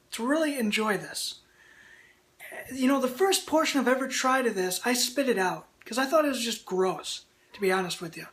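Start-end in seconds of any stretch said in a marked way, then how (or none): none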